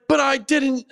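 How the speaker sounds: high, protesting mock shout; reenacted indignant denial; retold shouting match. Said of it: "shouting match".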